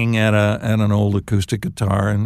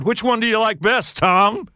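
mocking; 10 semitones higher than usual. [name] mocking him.

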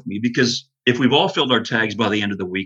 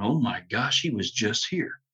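softer, hushed realisation; hushed shock; 7 dB quieter than usual. Retold or imitated. Hunter at his smashed blind, hushed and stunned. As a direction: breathe it out, stunned the bear was close.

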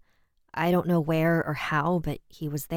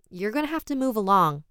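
higher-pitched, frustrated order; 6 semitones higher, 3 dB louder than usual. A narrator's reading. Homeowner orders the vagrant to leave, frustrated. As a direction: send him on his way, frustrated. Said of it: "waved my hand in frustration".

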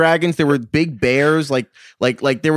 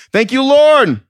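loud; 12 semitones higher, 7 dB louder, slower than usual. Acted. Thanking a guest grandly.

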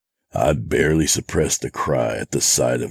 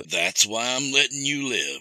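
high, cold mocking villain; menacing villain; 9 semitones higher, 4 dB quieter than usual. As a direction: toy with him like a killer. Villain man speaks.

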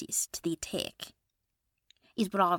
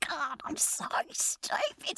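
self-scolding whisper; 9 semitones higher, slower than usual. Acted. Self-scolding after a slip.

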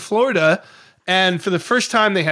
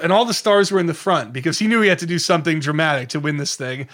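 ordinary talk, nothing marked

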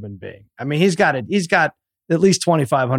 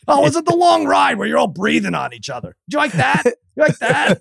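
high, needling mock voice; teasing friend; 11 semitones higher than usual. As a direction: poke fun at the kid. Needling son as friend Brad.

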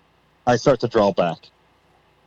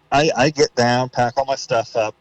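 ordinary talk, nothing marked

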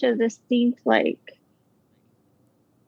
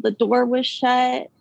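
ordinary talk

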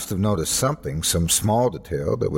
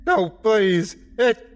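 high, panicked tearful begging; sobbing plea; 11 semitones higher, slower than usual. A narrator's reading. Sobbing gunman watching the trees, pleading in terror. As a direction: beg through sobs, voice pitched up. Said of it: "He was sobbing now".

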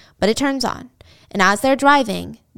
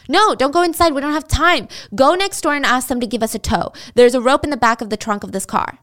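commanding; 4 semitones higher, 4 dB louder than usual. Retold or imitated. Billy ordering Jill.

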